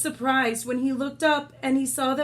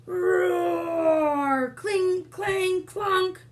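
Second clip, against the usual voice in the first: higher roar and clangs; battle sounds; 7 semitones higher, much slower than usual. Acted. Reader voicing the battle as Boy runs in. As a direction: growl then clang like metal.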